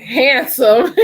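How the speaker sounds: laughing through the word, slowed. Real, not acted. Teacher amused by the boy calling himself handsome, laughing. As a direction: let the laugh carry handsome.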